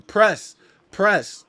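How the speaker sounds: loud, high, punched single word; exasperated courtside shout; urgent call-out. Real, not acted. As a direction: yell it at the team like a coach. Calling out to his team.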